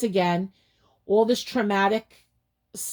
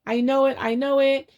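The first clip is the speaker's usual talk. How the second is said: retold panic; 6 semitones higher, 4 dB louder than usual. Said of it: "I was, like, freaking out".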